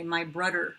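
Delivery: "brudder" with a plain D where the "th" belongs; kid's d for th. Retold or imitated. Mom imitating a child who says "brudder", D instead of th. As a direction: say "My brudder" with a D, like a little kid. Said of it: "a lot of kids use the D sound".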